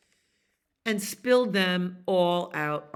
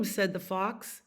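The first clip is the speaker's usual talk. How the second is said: ordinary delivery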